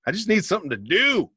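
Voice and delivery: comic pleading; mock desperation. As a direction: plead in a high, exaggerated voice. Acting out his eagerness for work.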